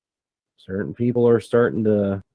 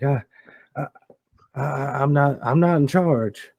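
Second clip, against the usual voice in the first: nervous stammer; 5 semitones higher than usual. Acted. Scared man answers, stammering.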